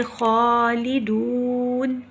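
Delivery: खालिदून with the ū stretched slow; long ū recitation. Shown as a demonstration. Teacher reciting for students, slow drawn खालिदून. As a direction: hold the ū of खालिदून. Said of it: "खालिदुन नहीं पढ़ेंगे".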